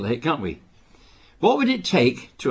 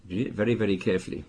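"very, very" ripetuto piano e rapido, più basso e morbido; quieter, faster repeat; 4 semitones lower, 6 dB quieter, faster than usual. Shown as a demonstration. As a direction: ripetilo sottovoce e di corsa.

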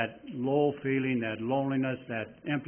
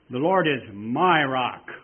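"my" weighted, whole line slow and much louder; emphasis on "my"; 8 dB louder, slower than usual. Shown as a demonstration. Preacher modelling personal emphasis for each listener, slow and loud. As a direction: say "The Lord is my rock" leaning on "my". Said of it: "putting the emphasis on these words".

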